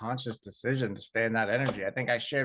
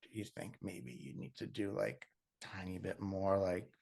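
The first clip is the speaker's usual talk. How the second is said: much softer, tentative suggestion; coaxing a fragile woman, much softer; gentle hint; 3 semitones lower, 6 dB quieter than usual. Retold or imitated. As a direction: tiptoe around it softly. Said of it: "I was like".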